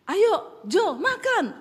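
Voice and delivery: loud, high Sulawesi-style call to eat; high-pitched call. Retold or imitated. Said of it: "Saya ngomong nadanya tinggi".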